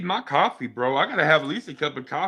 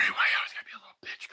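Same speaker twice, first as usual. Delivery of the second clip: whisper; 6 dB quieter, much faster than usual. Real, not acted. Muttered at dog.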